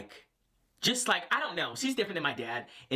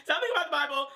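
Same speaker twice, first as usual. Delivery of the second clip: much higher, squeaky repeat of the phrase; 4 dB louder, faster than usual. Shown as a demonstration. Repeating the dad's topic to viewers, squeaky and high. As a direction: pitch it way up in a squeaky voice.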